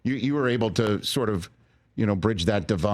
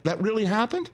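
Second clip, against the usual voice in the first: amazed; 13 semitones higher than usual. Real, not acted. Host amazed by coincidence.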